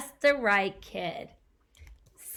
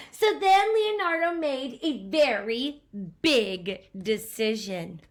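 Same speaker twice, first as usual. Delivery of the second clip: put-on lively read-aloud to a child; storybook narration; 7 dB louder than usual. A narrator's reading. Parent reading a picture book to a child, animated narration. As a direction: tell the story with bounce.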